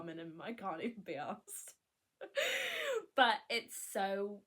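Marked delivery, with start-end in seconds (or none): 2.2-3.1 high squealing laugh. Real, not acted. Laughing at her own low bar.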